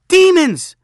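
very high, loud cry of alarm; frightened shout. Acted. Boys crowding behind their friend, high alarmed shout. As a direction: shriek the word as a scared echo. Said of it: "the other boys shouted".

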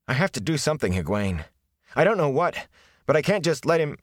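rising, strained, on the edge of breaking. Acted. Rand to Egwene about his father, voice close to breaking. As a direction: hold it together until it cracks. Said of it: "His voice broke".

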